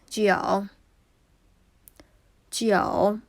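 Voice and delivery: careful third-tone "G O" demo; Mandarin third tone. Shown as a demonstration. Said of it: "Third tone".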